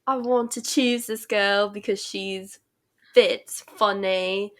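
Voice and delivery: wobbly put-on British accent that drifts country. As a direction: imitate a British guy, drawing out each reason. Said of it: "why am I speaking in a British accent".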